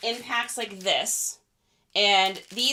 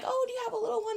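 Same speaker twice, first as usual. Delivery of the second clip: sweet lady voice; 6 dB quieter, much faster than usual. Retold or imitated. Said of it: "The lady's like".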